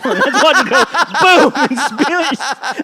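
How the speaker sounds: laughing, loud and high. Real, not acted. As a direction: crack up as you tell it. Laughing through the punchline.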